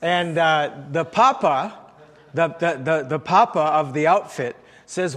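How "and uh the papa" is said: usual speaking voice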